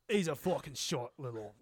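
mock London accent; Jason Statham impersonation. Host mocking another actor as Statham, mock London accent. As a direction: try out a rough Cockney insult. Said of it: "it was impersonation".

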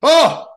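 short, high, loud scream; haunted house scream. Pretend. Listener in a haunted house, sudden loud scream. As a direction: let out a sudden high shriek. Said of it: "I screamed in the haunted house".

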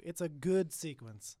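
mock the phrase It's a good sequence, leaning on good; teasing echo. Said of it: "let me hear your language back to you".

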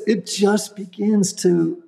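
usual speaking voice

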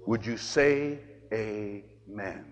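soft, slow and low, letting it land; hushed solemn question. Real, not acted. Priest challenging the congregation, hushed and slow. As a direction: ask it gently and gravely.

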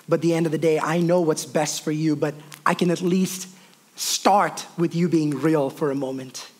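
low, soft, reassuring; understated, tender divine reply; gentle voice of God. Retold or imitated. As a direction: speak calmly as a patient father. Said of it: "God looks at that and says".

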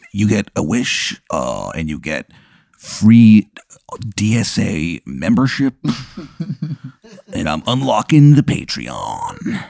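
soft, lightly gravelly creature voice; a hint of a rasping gargoyle. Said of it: "He goes".

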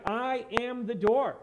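"I am the door" slow, each word distinct; very specific. Retold or imitated. Preacher quoting Jesus in verse nine, very specific. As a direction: stretch and weight "I am the door". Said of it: "He says very specifically".

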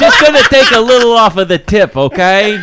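loud, high put-on voice; hammed-up gentle snipping voice; mock mohel voice. Acted quote of a mohel.

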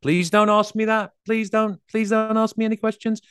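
high, anxious pleading. Retold or imitated. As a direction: beg them not to ask. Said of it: "with that upward inflection".

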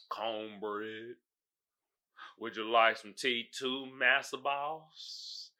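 low, soft, mock-subservient voice; hammed-up servile offer, quiet and low; imitating a deferential servant; mock slave servant.

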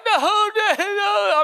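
mock child protesting, high and loud; whining kid. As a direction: wail it like a kid losing his game time. Son protests hour-a-day limit.

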